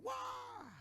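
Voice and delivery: very high, drawn-out mock scream; imitated scream. Woman as the demon leaves her, imitated scream. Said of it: "Dia teriak".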